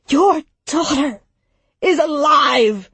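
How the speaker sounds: a strained roar choked with tears. Acted. Barnard roars at Samson over his dead daughter, strained. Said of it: "Barnard roared".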